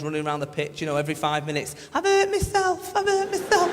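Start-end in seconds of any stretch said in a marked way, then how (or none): 1.9-3.7 high, mock whimpering; whining footballer. Mocking millionaire players on the pitch, high whining voice. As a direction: whine like a diving footballer.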